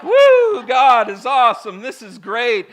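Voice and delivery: high, loud mock enthusiasm; fluffy cheering. Preacher mimicking how we read it, gushing and fluffy. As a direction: whoop like a giddy fan. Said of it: "like, fluffy".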